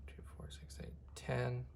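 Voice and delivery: very soft, half-whispered count; counting under breath. Real, not acted. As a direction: count quietly to yourself. Counting to himself while drawing.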